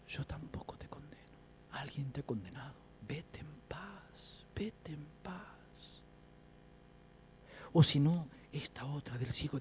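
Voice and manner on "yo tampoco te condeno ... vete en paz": whispered, slowing to a long-drawn farewell; gentle absolution. Acted. Jesus sending the woman off in peace, whispered. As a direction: whisper it tenderly, ever slower. Jesus forgiving, voiced softly.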